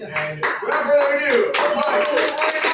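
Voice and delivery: loud, high title announcement; grand announcement. Real, not acted. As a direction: announce the scene title big. Host announcing the scene title.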